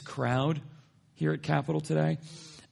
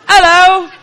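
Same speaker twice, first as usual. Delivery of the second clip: very loud, high-pitched Cockney holler; Cockney accent; 22 dB louder than usual. Retold or imitated. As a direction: yell it like a Cockney boy vendor. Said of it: "Had a Cockney accent".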